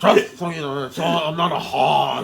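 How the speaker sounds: loud, high put-on rage with laughs; hammed-up furious producer. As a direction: rant like an insulted movie mogul. Repeats the mogul impression.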